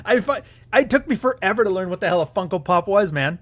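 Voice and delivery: laughing through the words, high; giggling as he admits it. Real, not acted. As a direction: breaks into laughter mid-sentence. Laughing while telling.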